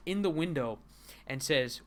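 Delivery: ordinary talk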